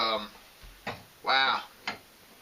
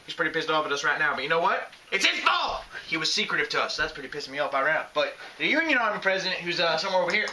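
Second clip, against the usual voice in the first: put-on Southern accent. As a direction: play a Southern newsman in his accent. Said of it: "All right, it's back".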